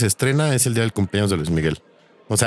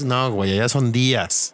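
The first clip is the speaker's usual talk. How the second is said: usual speaking voice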